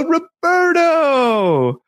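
high, very drawn-out cheer; mock group greeting, sung out high and long; townsfolk calling out. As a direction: imitate a crowd hailing its favorite. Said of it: "They go".

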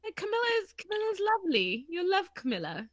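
high-pitched mock British lilt; British accent. Podcaster playing Charles selling Camilla to Diana, mock British accent. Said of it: "get to do my British accent".

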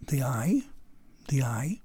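near-whispered question to oneself; hushed pondering. Acted. Alexander puzzling over the riddle on his descent, hushed. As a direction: softly puzzle it over, lifting at the end.

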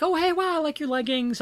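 high, bubbly mock compliment; imitating gushing receptionist. Imitating the receptionist complimenting her leggings, high and gushing. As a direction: pitch it way up like a chirpy fan. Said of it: "She'd say".

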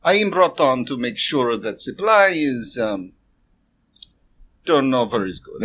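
halting shopkeeper in a put-on voice. Shopkeeper explaining the deal to a customer, halting and measured. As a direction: explain in careful, broken pieces with pauses.

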